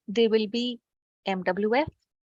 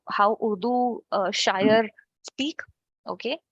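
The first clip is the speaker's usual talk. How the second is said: normal talking voice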